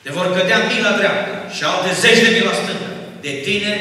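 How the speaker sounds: loud, high proclamation of a promise; proclaiming scripture. Real, not acted. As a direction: thunder the verse out to the congregation. Proclaims a Bible promise from the pulpit.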